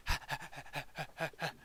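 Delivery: quick rhythmic panting like a happy hound; imitated dog panting. Game master voicing the phantom hound, quick panting. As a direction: pant rapidly like a dog. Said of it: "he gives you a nice".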